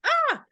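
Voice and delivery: high, loud "ah" of release; gasp of relief. Acted. Speaker shows finally breathing after holding your breath, relieved gasp. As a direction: let out a sudden high burst of relief.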